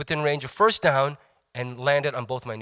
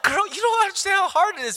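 much higher, exasperated whine; exasperated retort. Retold or imitated. Patient whining back at the technician, mock exasperated. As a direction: whine back at her in disbelief. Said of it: "I was like".

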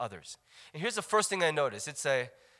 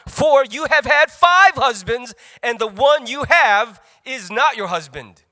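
emphatic reading; 4 semitones higher, 11 dB louder, slower than usual. Acted. Jesus' words read aloud.

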